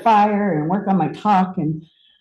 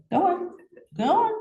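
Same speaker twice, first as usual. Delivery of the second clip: small, high, soft shooing voice; high-pitched coaxing; 10 semitones higher, 6 dB quieter than usual. Real, not acted. Speaker shoos a spider off mid-talk, high-pitched coaxing. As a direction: coax it away softly, way up high.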